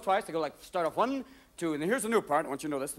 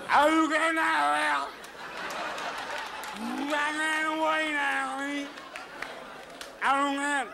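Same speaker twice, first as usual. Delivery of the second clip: put-on slow, high, mushy drunk slur; slurred drunk; 6 semitones higher, much slower than usual. Drunk after eight glasses of Jack Daniels, slow high slur. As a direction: lose all your consonants, one big vowel. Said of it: "you forget the English language".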